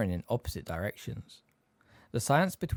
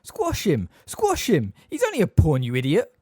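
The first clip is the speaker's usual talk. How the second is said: high, loud urging; enthusiastic egging on; 6 semitones higher, 8 dB louder than usual. A narrator's reading. Ron eggs his chess castle on, enthusiastically. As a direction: spur on a fighter with loud, punchy cries. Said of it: "egging it on enthusiastically".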